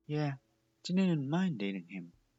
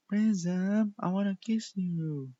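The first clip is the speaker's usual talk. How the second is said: higher and louder, bold; eager confession; 8 semitones higher, 6 dB louder, slower than usual. A narrator's reading. Candy telling Prism she wants to kiss him. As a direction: blurt it out eagerly.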